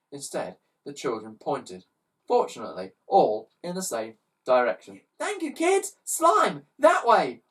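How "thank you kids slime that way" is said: high loud eager call; boy hero excitedly calling; eager orders. Acted. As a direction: call out and zoom off. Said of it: "ordered Ned".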